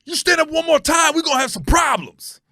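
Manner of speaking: loud, high yelled threat from the stands; imitating an angry fan shouting a warning; threatening shout. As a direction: bark it like a fan ready to fight. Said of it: "He goes".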